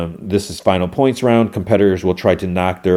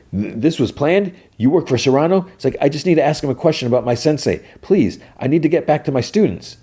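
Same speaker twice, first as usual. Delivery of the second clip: higher, hurried pleading; mock desperate pleading; 7 semitones higher than usual. Daniel begging captors to let him back, higher and hurried. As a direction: beg them to let you go.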